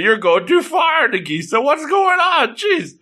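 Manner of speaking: high-pitched exasperated protest; mock alarm. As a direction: object in hammed-up disbelief. Acted alarm at her extravagance.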